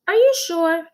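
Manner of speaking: high, loud incredulous question; doubtful teacher. Acted. Teacher doubting the children, high and loud. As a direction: ask in disbelief. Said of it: "asked Missus Jules".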